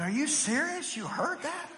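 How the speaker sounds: soft, high incredulous question; hushed disbelief. Retold or imitated. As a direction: ask it quietly in mock amazement. Retold hushed disbelief at wife's hearing.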